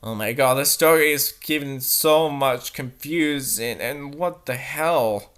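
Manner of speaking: ordinary talk, nothing marked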